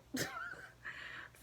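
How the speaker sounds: high, airy laugh; soft high-pitched giggle to yourself. Real, not acted. Laughs after finishing the story.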